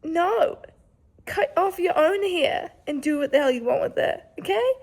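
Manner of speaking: loud mock telling-off; mock retort. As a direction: snap back at the imagined asker. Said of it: "I'd be like".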